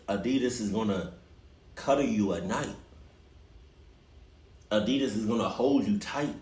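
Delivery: ordinary delivery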